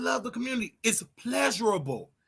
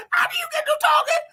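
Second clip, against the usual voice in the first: laughing; faster than usual. Real, not acted.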